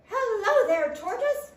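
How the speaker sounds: high, loud chirping bird voice; chirpy robin. A narrator's reading. Robin greeting sleepy Tortoise, chirpy. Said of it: "chirped Robin".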